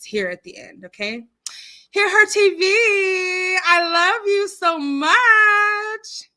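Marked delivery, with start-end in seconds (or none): 1.9-6.3 high, loud, drawn-out squeal; gushing shout. Real, not acted. Host greeting viewer who is the bomb, gushing shout. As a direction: shout the love out, stretching the words.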